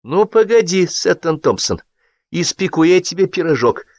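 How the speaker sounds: high, mock-threatening flare; a hint of long-held anger bursting; flaring up. A narrator's reading. Karina flaring at a guest, mild threat. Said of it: "Вспыхнула долго крепившаяся Карина".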